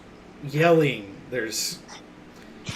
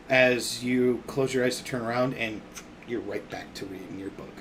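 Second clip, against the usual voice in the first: ordinary delivery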